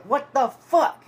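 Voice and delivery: slow, high, loud mock yell; imitated angry holler. Man forty yards away with arms up, imitated hollering angrily. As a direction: stretch it out like calling across a distance.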